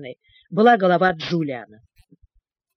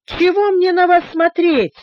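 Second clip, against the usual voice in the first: lively, higher, louder retort; 10 semitones higher, 5 dB louder than usual. A narrator's reading. Джулиан бойко огрызается на мистера Стика, живо и звонко. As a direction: toss it back briskly. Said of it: "живо ответил Джулиан".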